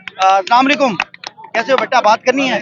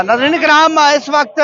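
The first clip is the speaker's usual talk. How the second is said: raised on-camera announcing voice; field news report; 6 semitones higher, slower than usual. Real, not acted. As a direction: address the viewers as a TV reporter.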